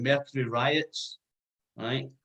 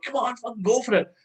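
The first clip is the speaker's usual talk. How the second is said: mock heckler cheer; 11 semitones higher than usual. Scottish fan urging the band on, mock cheering yell.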